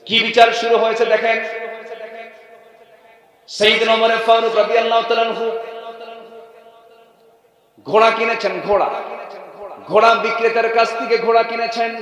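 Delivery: high, loud declamatory sermon chant; ringing preacher's delivery; full-force preaching. Acted. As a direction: proclaim it to the whole gathering on a high held pitch.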